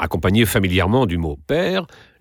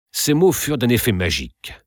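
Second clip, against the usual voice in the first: usual speaking voice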